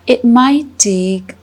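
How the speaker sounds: slow, unhurried meditation guide with long pauses; calm, measured guiding with drawn-out words; guided meditation. Acted. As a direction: ease listeners gently into stillness, phrase by phrase.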